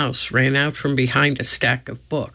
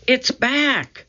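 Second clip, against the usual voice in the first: high excited call from afar; 10 semitones higher than usual. A narrator's reading. Annie telling Jack the treehouse returned, excited high call. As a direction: announce the big news thrilled.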